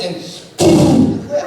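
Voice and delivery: a sharp stop like a full stop; period sound effect. Shown as a demonstration. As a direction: clip it off dead like hitting a period. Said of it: "you come to the end of the sentence".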